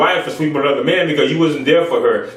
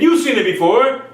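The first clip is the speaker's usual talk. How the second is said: ordinary talk, nothing marked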